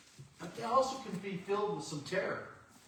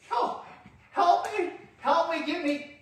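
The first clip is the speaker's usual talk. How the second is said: high, loud desperate yelling; frantic calls for help; 10 semitones higher, 6 dB louder than usual. Acted. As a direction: scream up for someone to save you. Man shouting again.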